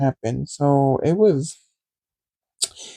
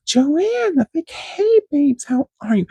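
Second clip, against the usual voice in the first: much higher, sugary hello with a forced smile; fake sweet greeting; 12 semitones higher than usual. Imitating herself greeting her ex-friend Joanne, high fake sweetness. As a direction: gush over her like a best friend.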